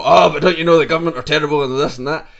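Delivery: loud, high, rapid mock rant; verbally aggressive. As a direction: act out flying off the handle in an argument. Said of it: "then we speak violently to someone".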